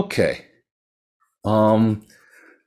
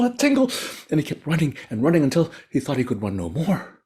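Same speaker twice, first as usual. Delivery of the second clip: higher, urgent, running narration; breathless chase; 6 semitones higher than usual. A narrator's reading. Narrator races through the merchant's panicked flight, higher and urgent. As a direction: tell it like you're fleeing too.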